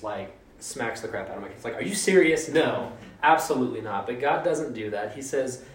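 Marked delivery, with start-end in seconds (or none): 1.8-2.5 higher, louder exasperated parent yell; angry parent. Retold or imitated. Angry parent yelling at a misbehaving kid. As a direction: bark it like a fed-up mom. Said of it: "I would be the angry parent".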